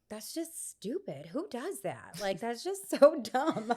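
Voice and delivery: slow, sneering put-down ending in a laugh; mock the workouts as pointless; scornful dismissal. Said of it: "and I'm like".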